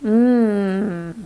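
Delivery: mm held long on a hum; a sustained, steady mm; M sound. Shown as a demonstration. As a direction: hum mm with lips closed. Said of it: "an M sounds like".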